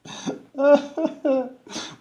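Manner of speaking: high, sustained mock sobbing; hammed-up wail; fake cry. The grapefruit keeps on crying, fake cry. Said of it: "that's kind of a fake cry".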